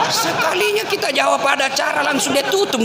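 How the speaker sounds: laughing through the words; real laughter at the caller hanging up. Real, not acted. A preacher laughs, telling of callers who hang up. Laughing at own phone story.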